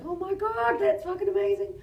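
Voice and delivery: very high, awed squeal; gushing amazement. Retold or imitated. Stunt woman reacting to director's film pitch, gushing. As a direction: gush in a high fangirl voice. Acts out her awe at pitch.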